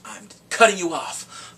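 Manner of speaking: loud, slow, drawn-out ultimatum; emphatic threat. Retold or imitated. Woman warning against calling cops, loud slow ultimatum. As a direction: lean hard and slowly on the threat.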